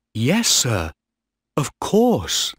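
lightly voiced keen boy, lifting high at the end; pleased young officer. A narrator's reading. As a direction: answer with a smile, pitch jumping up. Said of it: "Peter Heywood smiled".

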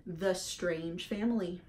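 ordinary delivery

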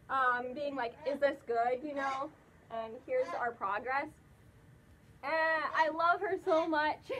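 muffled inside full mask; speaking behind thick padding; through a costume head. Real, not acted. Seen 4: Speaker wears an enclosed furry mascot head throughout the labelled words.

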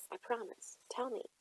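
quiet, pressing promise; soft hushed promise. A narrator's reading. Girl swears secrecy to Miss Melindy, quiet and hushed. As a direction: hush it, then urge her on. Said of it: "girl swears secrecy".

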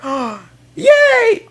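shrill put-on girly "yay"; mock girlfriend cheer. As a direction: play the clueless girlfriend cheering. Voicing girlfriend cheering interception.

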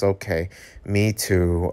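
ordinary delivery, nothing marked

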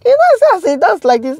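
high-pitched mimicry of gossipers; mimicking others. Vlogger mocking what people will come and say, high mimicry. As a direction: imitate them in a high, excited voice.

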